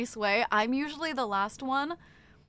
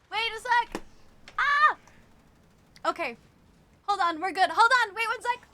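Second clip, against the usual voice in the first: high, loud flustered pleas; panicked; 11 semitones higher, 5 dB louder than usual. Real, not acted. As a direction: scramble to stop a spill. Scrambling to catch spilled water.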